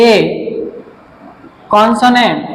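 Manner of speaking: slow word-by-word dictation with long pauses; dictating while writing. Real, not acted. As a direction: spell it out piece by piece for students copying it down. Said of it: "আমি লিখে দিচ্ছি". (লিখতে লিখতে থেমে থেমে, ধীরে বলে দেওয়া)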